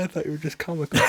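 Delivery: normal talking voice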